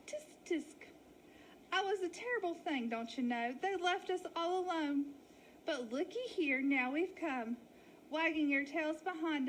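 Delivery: milk the sad lost story; wolf faking a sheep lady. Acted. Wally continues the act.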